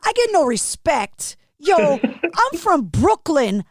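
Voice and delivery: hammed-up Brooklyn accent for laughs; put-on Brooklyn accent. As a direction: imitate an indignant Brooklyn guy. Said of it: "I'm gonna do this accent".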